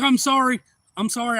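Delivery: loud, high mock apology; acted hasty apology. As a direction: call out sorry, imitating the shooter. Shooter apologizes after scare.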